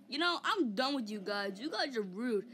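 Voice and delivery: ordinary talk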